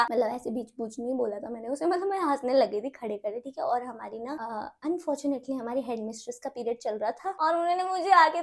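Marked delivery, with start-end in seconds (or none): none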